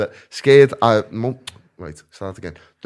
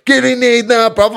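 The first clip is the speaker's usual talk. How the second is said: loud, high mock southern English aggressor; imitated southern English hard man shouting; southern English accent; 11 semitones higher, 8 dB louder than usual. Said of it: "'cause he's from down south".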